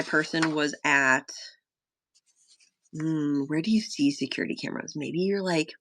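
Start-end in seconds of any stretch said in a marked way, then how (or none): none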